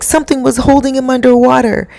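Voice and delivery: high, fast, urgent imitation; retold alarm. Girlfriend telling what happened to her son, retold alarm.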